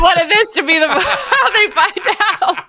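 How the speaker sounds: high, loud laughing speech; cracking up. Real, not acted. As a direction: squeal the words out through real laughter. Cracks up about spoiling Santa.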